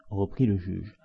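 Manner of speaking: usual speaking voice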